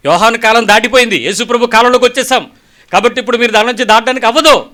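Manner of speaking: loud, high sermon climax; fiery preaching. Acted. Preacher warns the congregation that the time is over, loud and fiery. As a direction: thunder the warning at the congregation.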